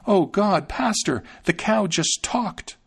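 high, slowing, breathless alarm; a maid blurting out her shock; frightened maid. A narrator's reading. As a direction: maid rushing to tell the pastor. Frightened maid runs to master.

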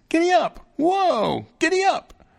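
very high, loud little-fellow yelps; shouted commands. A narrator's reading. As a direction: shout horse commands in a tiny voice. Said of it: "the little fellow shouted commands".

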